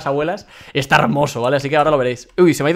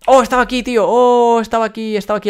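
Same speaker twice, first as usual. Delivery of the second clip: happy surprise; 6 semitones higher, 6 dB louder than usual. Real, not acted. Finds the item he sought.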